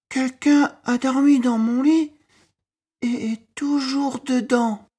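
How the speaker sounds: very high, loud cub shout; a tiny cub yelling loud enough to wake her; Baby Bear shouting. A narrator's reading. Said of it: "cria Bébé Ours si fort". (Bébé Ours qui crie, très aigu et fort)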